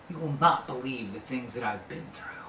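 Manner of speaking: low, soft, haunted confession; grave retold confiding. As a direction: imitate a troubled friend confiding quietly. Troubled friend confiding after long silence.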